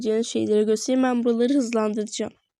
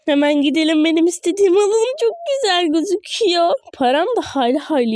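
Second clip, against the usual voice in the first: louder, higher, fast excitement; excited; 5 semitones higher, 5 dB louder, faster than usual. Real, not acted. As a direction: rush it out thrilled. Excited about buying item.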